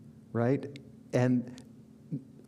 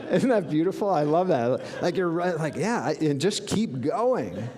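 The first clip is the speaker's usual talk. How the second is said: delighted; 5 semitones higher, 4 dB louder than usual. Real, not acted. Delighted by the cheer, laughing.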